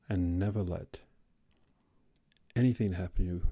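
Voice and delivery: very soft, low closing blessing; tender sign-off. Real, not acted. Podcaster sending listeners off with love, tender. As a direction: let it sink to a hush between long pauses.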